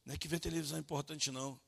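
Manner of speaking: ordinary talk, nothing marked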